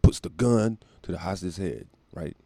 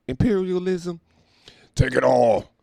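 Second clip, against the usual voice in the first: sarcastic mock; 10 semitones higher, 4 dB louder, slower than usual.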